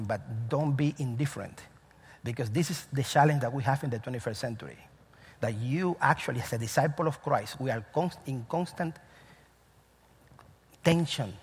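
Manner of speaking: normal talking voice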